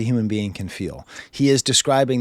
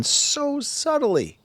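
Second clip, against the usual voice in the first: drawn-out praise; much slower than usual. Real, not acted. Praising subtle production.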